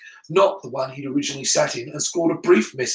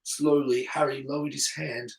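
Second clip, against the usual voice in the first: ordinary delivery, nothing marked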